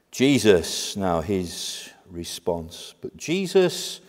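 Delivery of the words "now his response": ordinary delivery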